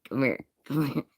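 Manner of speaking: hushed, fading to a whisper; secretive beckoning. Pretend.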